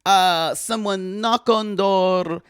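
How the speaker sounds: put-on high start, comic Eastern European accent; hesitant broken English, settling from high; cousin Olga. Visiting cousin describing apartment visits, halting accented voice.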